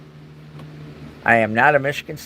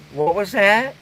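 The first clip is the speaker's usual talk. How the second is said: pet talk; 5 semitones higher than usual. Real, not acted. Talking to the dog Dakota.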